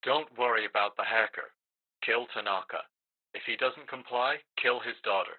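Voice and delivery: soft, distant voice as if over an earpiece; remote orders. Acted. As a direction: give cold orders from afar. Boss gives orders remotely.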